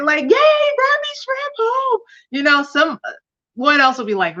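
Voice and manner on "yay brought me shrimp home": squealy, much higher and slow cheer; high, slow childlike cheer of delight; so excited. Retold or imitated. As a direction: play a delighted wife getting shrimp. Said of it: "I would be so excited".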